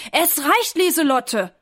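high, loud scolding outburst; loud angry call. A narrator's reading. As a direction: call out fed up at a pest. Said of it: "ruft ganz laut".

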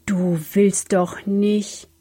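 slow, drawn-out disbelieving question; incredulous. A narrator's reading. Philipp stares at his brother's rope ladder, slowly incredulous. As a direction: draw it out in wary disbelief.

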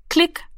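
high, short click imitation; clicking sound. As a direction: snap out a tiny click like a dial. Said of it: "machte es".

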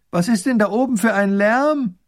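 high, loud call up the hill. Acted. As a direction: shout up from below. Princess calls from hill foot.

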